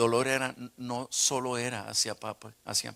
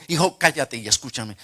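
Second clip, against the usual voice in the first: loud, firm fatherly interruption; stern command; 8 dB louder than usual. Retold or imitated. Father ordering his son to listen, stern command. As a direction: cut him off with authority.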